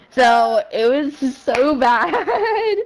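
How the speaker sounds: breaking into laughter on a stretched last word; real laughing. Real, not acted. Telling a friend how bad it was, laughing. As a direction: drag it out until laughter takes over.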